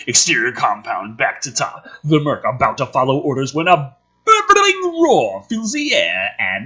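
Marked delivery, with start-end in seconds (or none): none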